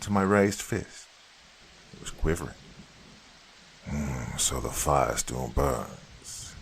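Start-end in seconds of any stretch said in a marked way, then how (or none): 3.9-6.5 low, slow and hushed, a dark man musing; deep voice. A narrator's reading. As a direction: draw it out low and quiet, amused. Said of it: "he remarked in his deep voice".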